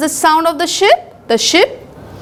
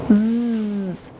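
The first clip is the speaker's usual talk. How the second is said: snore; 5 semitones lower, 4 dB quieter than usual. Shown as a demonstration. Teacher voicing father's snore.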